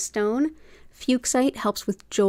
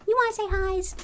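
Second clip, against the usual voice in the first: much higher squeaky coo; 12 semitones higher than usual. Real, not acted. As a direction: push it way up for the cat. Talking to the cat.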